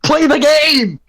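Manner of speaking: loud, high, exasperated push; aggressive shout. Real, not acted. Player urging an undecided friend at the game table, aggressive shout. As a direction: shout it at a dithering teammate. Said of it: "I'm getting aggressive".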